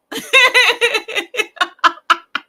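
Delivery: long, high, loud cackle; real giddy laughter; silly laughing fit. Real, not acted. Laughing at own silliness.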